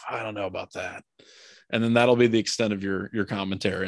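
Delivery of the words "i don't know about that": quiet, sucked-in-breath doubt; through clenched teeth. Retold or imitated. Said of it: "suck in through your teeth, your clenched teeth".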